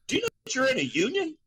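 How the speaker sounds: high, fast incredulous question; father quizzing his son about the union, high and fast; incredulous dad. Retold or imitated. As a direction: ask it in disbelief, mock dad. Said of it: "I'm like".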